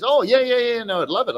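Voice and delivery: retold eager young drinker; eager kid imitated. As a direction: play the kid jumping at the offer. Voicing kid's eager reply.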